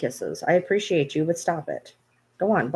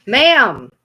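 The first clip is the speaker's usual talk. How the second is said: loud, drawn-out exclamation; mock outburst; 3 semitones higher, 10 dB louder than usual. Owner acting out the dog's reaction, loud mock outburst. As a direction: blurt it loudly, imitating her. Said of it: "Oh, she's all".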